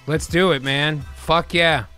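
ordinary delivery

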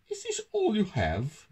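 high-pitched town mouse voice; Toby asking. A narrator's reading. Town mouse questioning his friend's food, high-voiced query. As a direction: ask it in a high storybook character voice. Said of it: "asked Toby Town Mouse".

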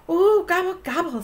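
high, bright-eyed ooh and gobble; excited gobble. Acted. Turkey gobbling excitedly about the extra special prize.

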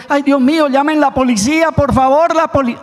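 high, alarmed call for police; frightened plea. Acted. Homeowner hearing a prowler at night calls out, alarmed and high. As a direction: gasp out for help in sudden fright. Hears noise at night, panics.

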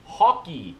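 "Hockey" with a firm falling tune; Canadian accent, land "Hockey" as a statement; practising Canadian accent. Shown as a demonstration. Vlogger practising "Hockey", Canadian accent, falling. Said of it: "I'll practice".

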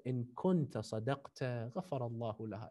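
the Imam forgiving his insulter quietly; kept his cool. A narrator's reading. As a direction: recite it gently with no heat. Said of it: "The Imam kept his cool".

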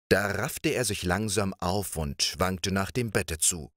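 usual speaking voice

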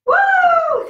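loud, high-pitched "woo"; high whoop. Real, not acted. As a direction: whoop it out at full energy. Whooping through star jumps.

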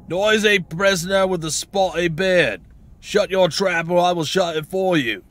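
high, forceful put-on jailer voice; threatening guard.

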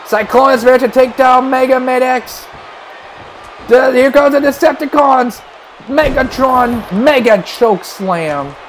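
usual speaking voice

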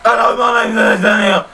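high, loud, put-on greeting; squeaky mock-formal hello; silly high voice. Shown as a demonstration. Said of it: "That's me normal".

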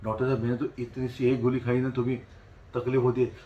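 low, meek complaint; acted Thuja patient. Thuja patient telling doctor one small pill causes trouble, meek. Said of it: "Thuja बोलता".

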